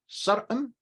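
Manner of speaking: "sarqam" with the r kept, pitched high; partly reduced form. Shown as a demonstration. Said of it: "you can say".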